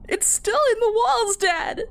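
high, frightened wail; scared child wailing for her father. A narrator's reading. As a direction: cry out to dad in terror. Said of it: "I wailed".

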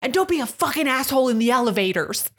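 high, loud parting shot; retold angry yelling. Holly leaving the fight, angry parting yell. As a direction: holler it back at them as you leave. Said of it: "I was yelling at them".

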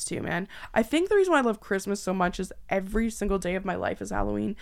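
normal talking voice

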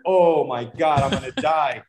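comic panic, laughing through it; retold panic. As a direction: replay being scared for your life, amused. Retold panic while being chased.

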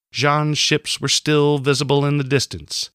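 plain reading voice

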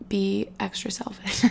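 breathy whispered aside, slowed down. Real, not acted. Vlogger whispers a guilty confession to her viewers.

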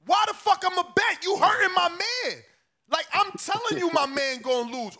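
high-pitched, exasperated protest; indignant outburst. Acted. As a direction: fire back in outrage, pitch jumping up. Outraged reply to callers' bets.

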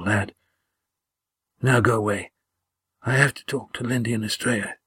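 halting voice of a badly hurt man; weak, slow whispering. A narrator's reading. Wounded Gar sending Damon away, weakly whispered. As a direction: labour it out slowly but coherently. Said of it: "as he got enough control to whisper".

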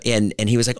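usual speaking voice

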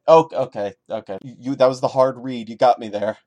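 loud mock surrender; sarcastic concession. Real, not acted. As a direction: concede with a smirk.

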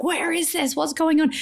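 high, anxious questions; alarmed. Retold or imitated. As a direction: sound startled and searching. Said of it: "I was like".

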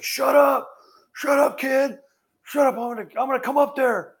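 high, strained mock shout; imitating a fed-up catcher yelling; acted angry yell. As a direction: snap back at a heckler. Catcher finally snaps at heckler.

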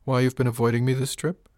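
statement lifted into a question on "trip"; questioning rise. A narrator's reading. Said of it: "Her inflection rose at the end, making her statement a question".

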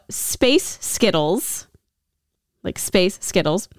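"Space Skittles" said slowly, each word separate; slow wordplay demo. Shown as a demonstration. Host showing co-host a sound-alike, slow "Space Skittles". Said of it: "is Space Girls in Scottish".

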